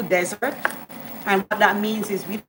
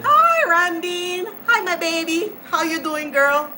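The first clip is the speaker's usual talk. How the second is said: gushing greeting; 8 semitones higher than usual. Real, not acted. Greeting a viewer fondly.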